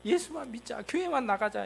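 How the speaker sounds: put-on a parent begging a child to go to church; pleading parent. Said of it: "이렇게 얘기들 하시잖아요". (자식에게 애원하는 부모 목소리)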